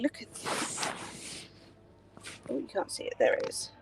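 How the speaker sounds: hushed whisper to camera; whispering while filming. Real, not acted. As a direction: whisper it as if not to be overheard. Filming something, hushed aside.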